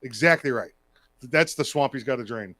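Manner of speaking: normal talking voice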